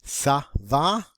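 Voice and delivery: ÇA VA starting with an S sound; soft C. Shown as a demonstration. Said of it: "pronounced like a soft C".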